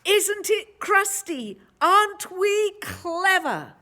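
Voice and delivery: high, loud proud shout; mighty shout. A narrator's reading. Villagers shout proudly over their loaf. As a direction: crow with pride. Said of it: "raised a mighty shout".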